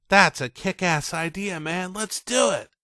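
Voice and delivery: put-on high, eager teen voice; excited agreement. Ray hyped about the ski jump, excited. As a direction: jump at the idea. Ray loves the ramp idea.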